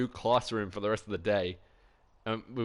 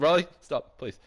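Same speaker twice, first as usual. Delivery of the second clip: urgent plea; 5 semitones higher, 6 dB louder than usual. Retold or imitated. Begging friend to stop talking.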